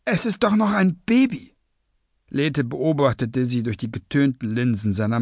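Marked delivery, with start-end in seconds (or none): 0.0-1.5 high, imploring woman's voice; pleading. A narrator's reading. Woman begs leader to spare the baby, pleading. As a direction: implore in a raised pitch. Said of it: "flehte Quibble".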